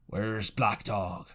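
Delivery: slow, low cry from the sick captain. A narrator's reading. Waking captain asking after his enemy, slow low cry. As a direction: drag it out like a waking sick man.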